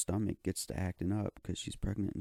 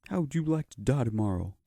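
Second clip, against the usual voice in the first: teacher; 6 semitones higher, 7 dB louder than usual. Retold or imitated. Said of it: "he stood up there and said".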